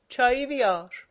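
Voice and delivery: loud call for tea; shouting. A narrator's reading. Dashakol shouting to the house for tea, loud. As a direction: yell the order across the house. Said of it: "داد زد".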